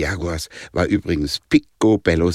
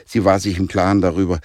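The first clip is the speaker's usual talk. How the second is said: plain reading voice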